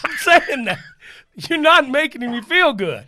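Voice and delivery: laughing protest. Retold or imitated. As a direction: complain through laughter. Protesting to husband.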